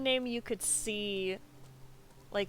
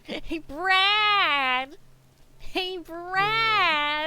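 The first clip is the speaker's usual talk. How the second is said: cheerful shout, higher and louder; calling out; 7 semitones higher, 6 dB louder, much slower than usual. Acted. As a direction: call Brad across the room. Calling out loudly to character.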